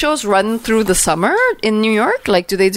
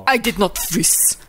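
quoting a movie line; 4 dB quieter, slower than usual. Retold or imitated. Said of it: "Did he freeze?".

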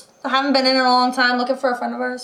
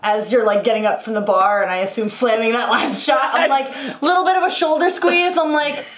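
ordinary delivery

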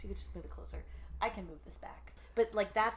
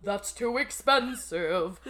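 slow, louder theatrical line; hammed-up stage line; 7 dB louder, slower than usual. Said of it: "And it's like".

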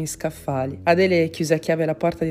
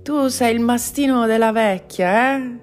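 louder, higher thug's taunt; goading thug voice, raised; accosting thug; 6 semitones higher, 5 dB louder than usual. A narrator's reading. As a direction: challenge him as one of the enemy. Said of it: "lo apostrofò il terzo".

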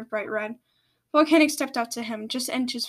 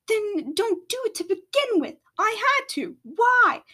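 desperate high cry; high, pleading; 10 semitones higher, 4 dB louder than usual. Acted. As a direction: voice climbing high in anguish. Spew pleads heatedly.

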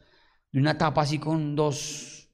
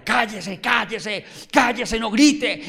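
crowd hushing; 6 semitones higher, 5 dB louder, slower than usual. Acted. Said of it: "la gente comenzó a callarlo".

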